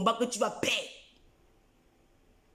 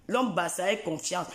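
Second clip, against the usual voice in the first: usual speaking voice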